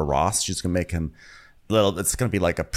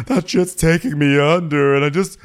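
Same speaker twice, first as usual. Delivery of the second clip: Marlo; 10 semitones higher, 6 dB louder than usual. Retold or imitated. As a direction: beg for sympathy, hammed up. Mimics Marlo pleading for sympathy.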